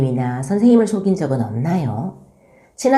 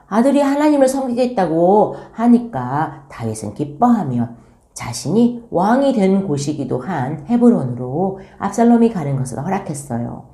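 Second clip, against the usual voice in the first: ordinary talk, nothing marked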